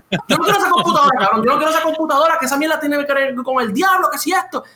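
high, rushing panicked protest; mock panicked refusal. Imitating scared friend rejecting the haunted computer, mock panic.

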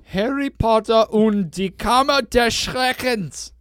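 slow, loud, high German title reading. Shown as a demonstration. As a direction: declaim it in German like an announcer. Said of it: "let me try to read that".

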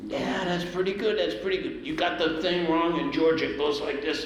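high-pitched impression of a grudging bandleader. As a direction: imitate an older musician correcting a player. Imitating [name] correcting his piano playing.

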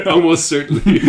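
high, loud words through laughter; laughing. Real, not acted. Cohost joking about Pope Joan, laughing. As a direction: answer while cracking up.

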